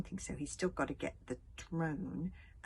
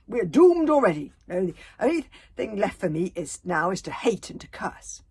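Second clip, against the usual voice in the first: bitter raging; 2 semitones higher, 15 dB louder than usual. A narrator's reading. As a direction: spit out doom and hate. Grandmother raging on.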